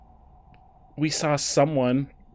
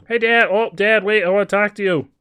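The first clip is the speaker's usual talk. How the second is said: calling out; 6 semitones higher, 8 dB louder than usual. Acted. Calling out to dad character.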